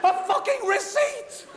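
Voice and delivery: incredulous outburst. Real, not acted. Comic telling the crowd about the receipt, laughing disbelief. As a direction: stretch out fucking in laughing disbelief.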